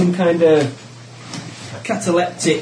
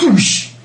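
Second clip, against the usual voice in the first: high whoosh sound effect; quick spoken swoosh of someone collapsing; imitated whoosh; 10 semitones higher, 5 dB louder than usual. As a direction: voice a sudden drop as a noise. Imitating her sudden collapse.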